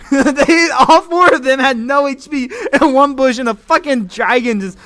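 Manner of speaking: laughing, high and loud hype; genuine excitement. Real, not acted. Streamer recounts a wild team fight, genuinely excited. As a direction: yell the play-by-play in real amazement. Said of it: "that was genuine excitement".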